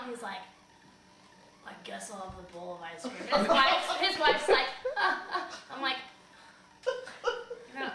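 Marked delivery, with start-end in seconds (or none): 1.6-3.1 low, soft mock resignation; imitating a let-down old man; deflated giving in. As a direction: give in grudgingly, dropped low and quiet. Rejected man gives in to dessert.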